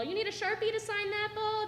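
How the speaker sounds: playful high coach tease; quoting coach. Retold or imitated. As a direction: toss it off like a teasing coach. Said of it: "like what Mon Holland used to say".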